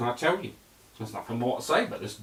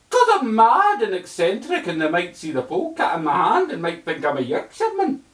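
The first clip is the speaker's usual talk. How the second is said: louder, higher eccentric voice; hammed-up mad Doctor; 9 semitones higher, 10 dB louder than usual. The Doctor explaining to his companion, hammed-up voice.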